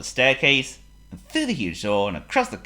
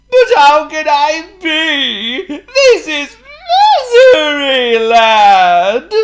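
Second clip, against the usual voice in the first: high, drawn-out despairing wail; hammed-up loud sobbing; crying king; 17 dB louder, much slower than usual. A narrator's reading. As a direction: bawl it slowly, building to a shout. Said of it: "cried the king".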